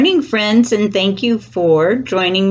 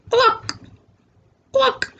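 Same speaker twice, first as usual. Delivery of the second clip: high, short hen clucks; two separate high-pitched clucks. A narrator's reading. Storyteller voices the mommy hen, high clucks. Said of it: "The hen says".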